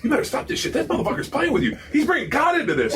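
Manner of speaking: high-pitched panicky teammate, imitated; urgent, high mock alarm; frantic warning. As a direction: act out an alarmed warning with laughter creeping in.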